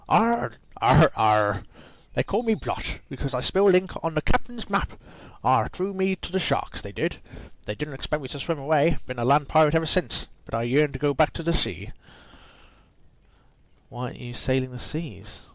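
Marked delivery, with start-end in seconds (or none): 0.1-11.9 put-on pirate accent. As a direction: growl out a bad pirate accent. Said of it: "I can't do pirate accents".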